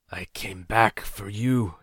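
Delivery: slow, groggy mutter in another voice. A narrator's reading. Wounded elder mutters to his companion, slow and dazed. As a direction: let it drift out, eyelids heavy. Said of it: "he muttered".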